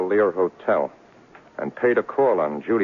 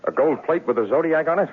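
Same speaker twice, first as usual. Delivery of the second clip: sharp suspicious surprise; 10 semitones higher than usual. Acted. Detective confronts lying client.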